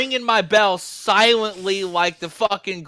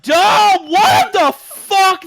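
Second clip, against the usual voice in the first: loud, high mock-horrified yell; shocked outburst; 9 semitones higher, 8 dB louder, much slower than usual. Retelling finding ants in his drink, mock shocked shout. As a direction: blurt it out like you just saw ants pour from the tap.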